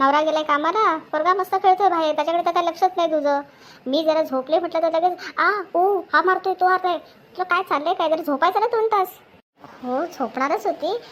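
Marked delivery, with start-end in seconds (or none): 0.0-9.2 loud, higher-pitched nagging; acted scolding. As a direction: scold her for waking you, pressing hard. Woken elder woman scolds the viewer.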